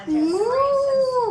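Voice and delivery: cow moo. Pretend. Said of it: "Now I'm a cow".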